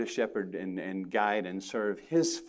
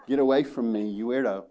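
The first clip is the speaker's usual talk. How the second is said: mock outsider. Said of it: "you'd probably say".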